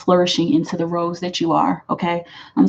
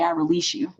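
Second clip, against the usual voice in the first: "I release you" floated high and soft, rising on "I"; soft, higher ritual "I release you" said over the fire; 11 semitones higher, 6 dB quieter than usual. Shown as a demonstration. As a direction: lift "I release you" up high and let it go gently. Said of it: "burn it on the full moon and say".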